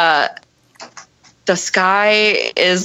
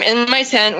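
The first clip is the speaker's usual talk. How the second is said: normal talking voice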